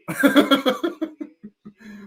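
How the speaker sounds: high breathy run of chuckles; amused laugh. Real, not acted. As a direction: crack up at your own joke. Laughs at his own health advice.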